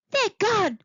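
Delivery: high happy little squeak. Acted. Eddie seeing the pink things vanish, high happy squeak. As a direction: squeal it with relief. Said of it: "squeaked Eddie happily".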